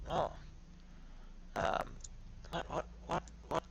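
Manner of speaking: stammering, jumping high in alarm; flustered panic. Acted. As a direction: caught off guard, backing away. Cage panics when poked.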